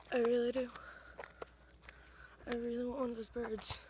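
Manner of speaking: ordinary talk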